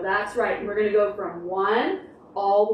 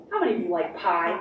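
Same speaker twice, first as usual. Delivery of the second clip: ordinary delivery, nothing marked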